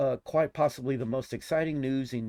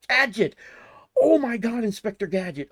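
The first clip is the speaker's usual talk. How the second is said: loud, high fan exclamation; excited outburst; 11 semitones higher, 8 dB louder than usual. Real, not acted. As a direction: burst out with delight at a favorite name. Excited discovery while reading the list.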